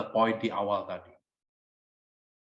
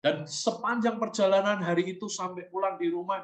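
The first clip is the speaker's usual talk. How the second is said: ordinary delivery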